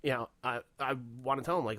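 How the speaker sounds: usual speaking voice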